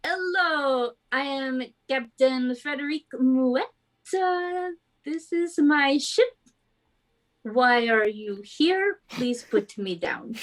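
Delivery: put-on ship's captain in a vaguely French accent; bad French accent. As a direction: introduce yourself in a hokey French accent. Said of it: "the worst French accent to ever exist".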